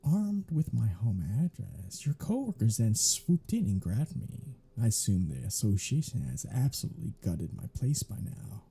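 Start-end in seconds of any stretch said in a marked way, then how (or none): none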